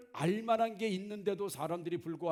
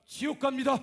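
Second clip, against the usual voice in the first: much higher and louder proclamation; loud warning; 8 semitones higher, 7 dB louder than usual. Retold or imitated. Preacher telling a worshipper he's going to hell, loud. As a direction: declare it as a stern pronouncement.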